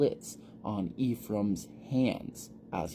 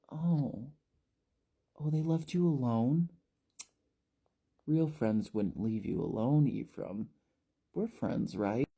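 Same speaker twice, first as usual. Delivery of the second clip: high, put-on wheedling voice; creepy false friend; 4 semitones higher than usual. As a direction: reel him in with fake warmth. Shelley manipulating lonely Ephraim.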